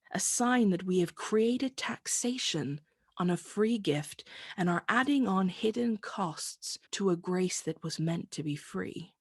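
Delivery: normal talking voice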